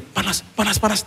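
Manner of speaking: high, soft little yelps; startled wife. Retold or imitated. Wife feeling the heat leave through her hand, startled. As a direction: squeak it softly, as if suddenly burnt.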